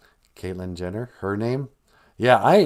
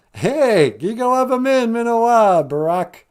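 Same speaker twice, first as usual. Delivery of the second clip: higher, louder playful puppet call; high loud wife voice; 10 semitones higher, 7 dB louder than usual. Acted. Michelle puppet loud greeting.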